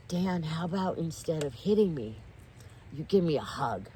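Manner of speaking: ordinary talk, nothing marked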